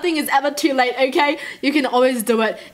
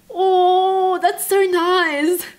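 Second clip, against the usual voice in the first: very slow, higher, choked-up awe; teary and touched; 6 semitones higher, much slower than usual. Real, not acted. Reacting to the coffee shop sheltering strays, teary and touched. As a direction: let it catch in your throat.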